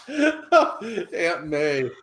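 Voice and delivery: laughing. Real, not acted. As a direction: shout the answer mid-laugh. Answer blurted through laughter.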